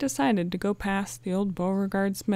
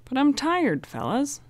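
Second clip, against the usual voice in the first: whiny; 7 semitones higher, 4 dB louder than usual. A narrator's reading. Chet whining about being tired.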